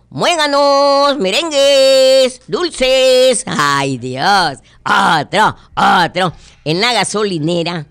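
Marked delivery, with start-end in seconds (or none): 0.1-3.4 high, slow, drawn-out street vendor call; a child mimicking a candy vendor's slow, high call; vendor's cry. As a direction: sing out the sweets like a street seller.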